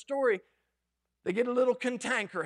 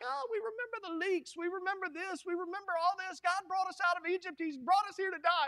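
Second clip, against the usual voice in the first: high, softer whiny complaining; higher, quieter mock cry-baby whine; mock whining; 7 semitones higher, 4 dB quieter than usual. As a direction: whine like homesick grumblers. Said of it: "they start crying out so much".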